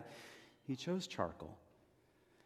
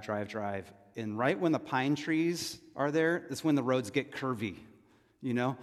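usual speaking voice

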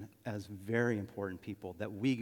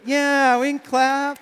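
loud, high and bright call; rally the room to applaud; excited call to clap; 16 dB louder, slower than usual. Real, not acted. Calling congregation to applaud.

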